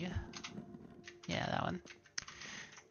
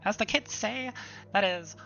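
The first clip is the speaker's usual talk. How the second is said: excited nerd; 6 semitones higher, 8 dB louder than usual. Acted. Voices moved excited historian NPC.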